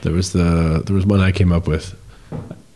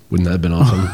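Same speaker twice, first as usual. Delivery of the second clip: usual speaking voice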